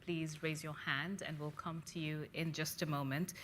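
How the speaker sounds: normal talking voice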